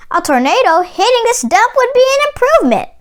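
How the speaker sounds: a lightly played disgusted exclamation; exclaimed. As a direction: rattle it off in disbelief at the dump. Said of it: "Jack exclaimed".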